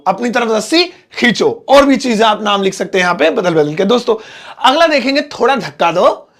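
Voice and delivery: usual speaking voice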